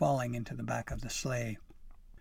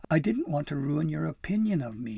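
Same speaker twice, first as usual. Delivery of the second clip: raised, unhappy appeal; loud, pained apology; wretched; 5 semitones higher, 8 dB louder than usual. A narrator's reading. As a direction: explain yourself miserably. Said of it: "unable to speak for wretchedness".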